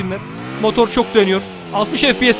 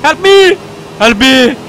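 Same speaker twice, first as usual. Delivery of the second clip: high, loud playful plea; hammed-up call for help to a teammate; loud pretend yell for help; mock cry for help; 4 semitones higher, 7 dB louder, much slower than usual. Calling for help in the game.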